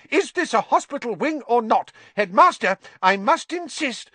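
exasperated nurse near hysterics; hysterical matron. Acted. Nurse protesting visitors in her hospital wing, hysterical and high. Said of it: "said Madam Pumphrey hysterically".

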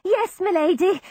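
high, eager servant's reply; a nervous servant's yes. A narrator's reading. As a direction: answer the lady obediently. Servant answering hurriedly.

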